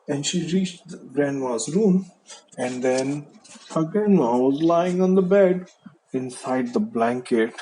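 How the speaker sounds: ordinary delivery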